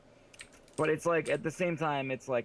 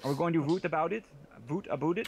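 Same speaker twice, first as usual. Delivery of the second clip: higher-pitched, exaggerated Canadian "oo" vowels; mock Canadian accent; 5 semitones higher than usual. Mimicking the voter back to them, mock Canadian accent. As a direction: tease with a hammed-up Canadian "voot aboot it". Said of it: "very Canadian".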